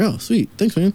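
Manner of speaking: high, happy "Oh, sweet"; chirpy thanks; grateful thanks. Retold or imitated. Speaker thanks the barista for the free drink, high and chirpy. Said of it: "I was like".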